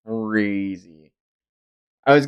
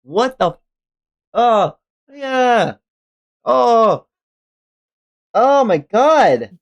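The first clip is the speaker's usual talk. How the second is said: real disgust, louder and higher; grossed out by a creepy tree; 8 semitones higher, 5 dB louder, slower than usual. Real, not acted. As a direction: groan in revulsion at the picture. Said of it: "I hate it".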